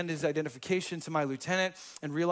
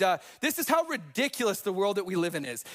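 normal talking voice

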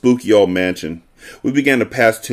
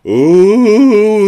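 high, loud spooky moan; moaning ghost scream; 12 semitones higher, 7 dB louder than usual. Retold or imitated. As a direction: wail like a haunted house ghost. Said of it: "a horrible moaning scream".

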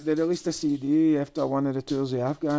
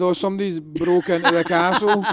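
mock alarmed guard; 4 semitones higher, 6 dB louder than usual. Mocking the film's castle guards.

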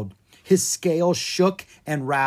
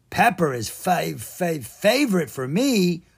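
stumbling ogre, stuck on fav-; a clumsy ogre tripping over favorite; 4 semitones higher than usual. Acted. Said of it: "the ogre said".

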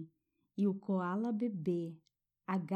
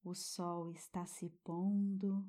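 guided meditation. Acted. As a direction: soothe a child toward sleep.

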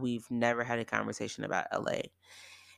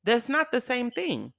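indignant correction; 9 semitones higher than usual. Retold or imitated. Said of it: "And I got mad".

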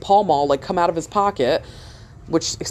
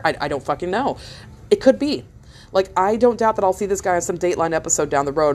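ordinary delivery

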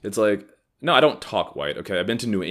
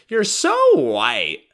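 put-on very high, slow drawn-out sneering girl voice; mock the girl's taunt, stretched out; high nasal-free mocking drawl; 7 dB louder, much slower than usual. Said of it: "got right in my face and was like".

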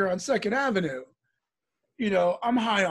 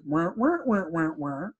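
imitated garbled man; 2 semitones lower, slower than usual. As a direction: answer as a faceless man in mumbled wahs. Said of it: "the guy turns around and answers".

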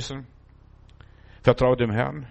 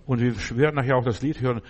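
ordinary talk

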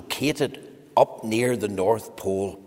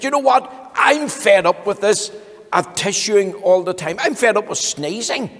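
higher, louder fed-up complaint; fed-up Mister Sneeze; 7 semitones higher, 5 dB louder than usual. Acted. Mister Sneeze complains he is fed up with sneezing. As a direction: sound sick of it all.

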